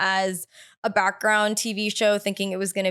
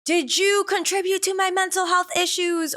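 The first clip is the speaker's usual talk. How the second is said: high, raised mock yell of an angry son; imitated yelling; 11 semitones higher than usual. Son confronts his mother in documentary, imitated high yell. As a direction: throw it at her like an accusation.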